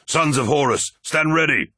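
rally the troops with a shout; commanding shout. A narrator's reading. Captain rallying his warriors against the dead, shouting. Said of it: "he shouted".

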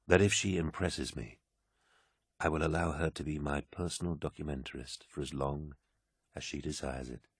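quiet, measured granting of favour; composed warlord. A narrator's reading. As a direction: grant it softly, calm after anger. Narrator voices Horus, outburst over.

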